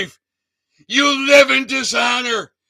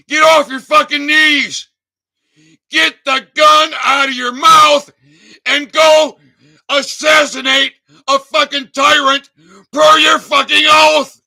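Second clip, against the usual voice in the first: a real tirade, voice up and blasting; furious shout; 2 semitones higher, 4 dB louder than usual. Real, not acted. Shouting at veterans to rise, furious yell. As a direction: yell it with raw fury, louder and louder.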